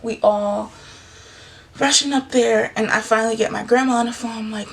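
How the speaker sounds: normal talking voice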